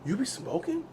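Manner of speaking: stunned question; incredulous. Retold or imitated. Confronting dad.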